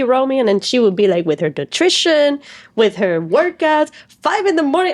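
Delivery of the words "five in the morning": disbelieving rise, high pitch; stunned she gets up that early; incredulous. Retold or imitated. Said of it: "incredulous repeat".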